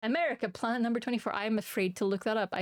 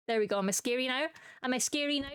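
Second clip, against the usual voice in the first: usual speaking voice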